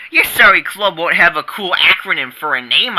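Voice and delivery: normal talking voice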